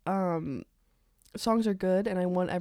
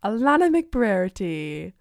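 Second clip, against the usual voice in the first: slow, louder announcer voice; radio shout-out; 2 semitones higher, 4 dB louder than usual. Acted. Doing a podcast shout-out in announcer voice. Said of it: "Don't do that either. That sounds creepy".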